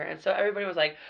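usual speaking voice

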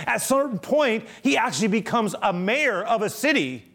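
high-pitched, fervent sermon delivery; impassioned preaching. Real, not acted. Preacher telling how grace changed Valjean, impassioned preaching. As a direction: preach it at full force, phrase piling on phrase.

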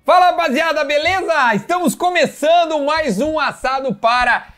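loud, high shouted show opening; shouting. Real, not acted. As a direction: yell the welcome to the crowd. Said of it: "eu gosto de gritar".

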